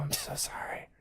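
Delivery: unvoiced whispered apology. Real, not acted. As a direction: drop to a whisper.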